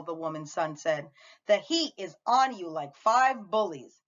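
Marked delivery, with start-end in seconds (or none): none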